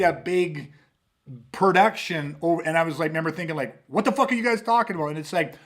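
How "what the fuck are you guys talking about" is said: fast, high-pitched incredulous question; re-enacted baffled reaction, quick and high; retold bafflement. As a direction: fire it off in mock confusion. Acts out own baffled thought.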